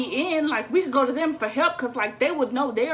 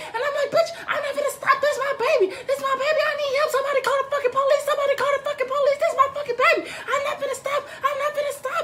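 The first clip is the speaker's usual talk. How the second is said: frantic hysterical yelling; 13 semitones higher, faster than usual. Retold or imitated. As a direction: scream it like you'll never let go. Frantic mom refuses to stop.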